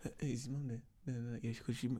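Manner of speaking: asking from behind the corner; low hushed question; hiding and whispering. Retold or imitated. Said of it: "I'm hiding around the corner".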